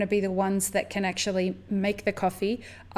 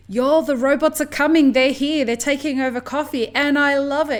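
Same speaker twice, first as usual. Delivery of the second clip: loud, higher-pitched excited retelling; excited customer; 6 semitones higher, 7 dB louder than usual. Retold or imitated. Woman retelling a happy robot-coffee customer's reaction, excited. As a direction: imitate a stoked customer, louder and higher. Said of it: "He was so excited that he's like".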